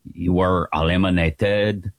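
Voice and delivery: low, badly faked French accent; terrible French accent. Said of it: "I'll do this terrible accent".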